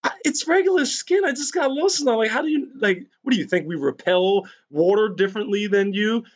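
high-pitched retold protest; mock incredulous. Retort to a friend's father about water-repellent skin, incredulous. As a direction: voice jumps up in disbelief, imitating himself.

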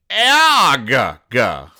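high, loud startled shriek then a grunt; startled yelp. Retold or imitated. Startled by his wife, a loud high yelp. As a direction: Dagwood jumps out of his skin, yelping. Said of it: "It's A A U G H".

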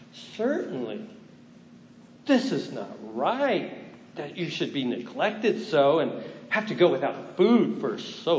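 theatrical tempting devil voice; hammy stage actor. Acted. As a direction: ham it up as the sly devil. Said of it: "Pardon my theatrics".

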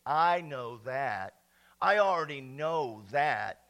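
low, slow drawl imitating a know-it-all; mimicked dismissive reply. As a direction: drag it out like someone who won't listen. Mimics people dismissing his advice.

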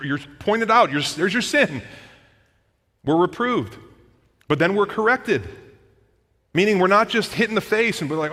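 high-pitched, loud pulpit delivery; impassioned preaching. Acted. Preacher explaining reproof and correction to the church, impassioned. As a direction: build urgency with high, loud, punched phrases.

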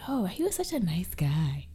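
hushed mock gossip whisper. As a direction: breathe it like a secret. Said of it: "And people would say".

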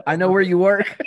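laughing through a mock threat; joking threat, cracking up. Host laughs, joins the joke.

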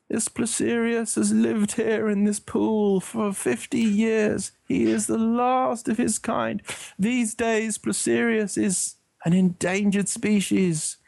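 slow, high-pitched documentary narrator; Kenneth Branagh impression. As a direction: solemnly introduce a rare beast, stretching the words. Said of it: "put on my best Kenneth Branagh voice".

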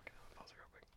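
real whisper, very soft. Real, not acted. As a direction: whisper so the mic won't catch it. Pausing the recording.

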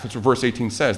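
ordinary talk

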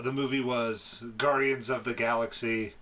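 usual speaking voice

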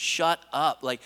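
mild imitated yell; retold yelling. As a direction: scold him like an annoyed crowd. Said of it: "like, yelling at the dude".

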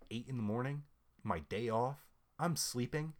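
ordinary delivery

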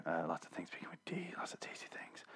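hushed secret aside; whisper. Pretend. Podcaster joking to listeners about tasty D things, whispered. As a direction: whisper it like a greedy confession.

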